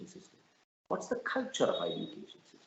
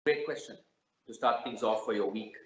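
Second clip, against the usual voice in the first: normal talking voice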